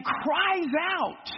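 drawn-out, falling cries out; slow cries out. Shown as a demonstration. Preacher reading to his class, slow and drawn out. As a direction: stretch cries out slowly. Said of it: "Say, "Cries out".

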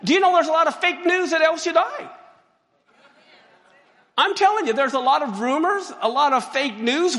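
high, loud, fast preaching call; excited shout. Real, not acted. Preacher warning about rumors.

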